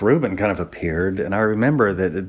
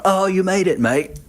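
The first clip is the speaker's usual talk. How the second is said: cheery Strummer greeting; 12 semitones higher, 4 dB louder than usual. Retold or imitated. Strummer greets him at garage.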